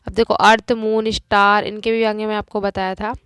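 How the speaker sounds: normal talking voice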